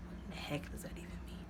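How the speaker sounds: whispered, puzzled mock question; imitated whisper. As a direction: murmur it as if baffled under breath. Puzzled captor reads the note.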